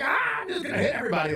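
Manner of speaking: very high, fast frantic yelp; mock wild aggression. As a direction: rush it out like someone out of control. Acting out undisciplined chaos.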